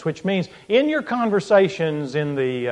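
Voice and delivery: ordinary delivery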